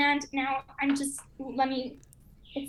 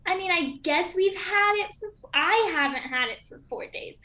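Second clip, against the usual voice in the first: usual speaking voice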